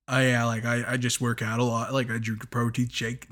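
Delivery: lightly voiced dumb gym bro, low and soft; imitating James. Podcaster mocking James the gym guy, a soft mock bro voice. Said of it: "that accent developed as well".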